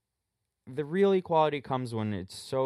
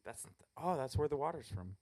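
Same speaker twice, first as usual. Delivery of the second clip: whispering; 4 semitones higher, 9 dB quieter than usual. Real, not acted. Host drops to a whisper too.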